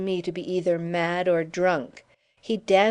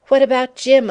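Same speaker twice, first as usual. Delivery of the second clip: much higher, louder demand; urgent question; 7 semitones higher, 9 dB louder than usual. A narrator's reading. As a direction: press her hard about Jim. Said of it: "I asked".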